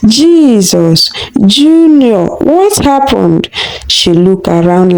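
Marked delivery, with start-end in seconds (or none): 0.1-3.5 panicked scream. Acted. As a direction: scream in terror for the child. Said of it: "and screamed".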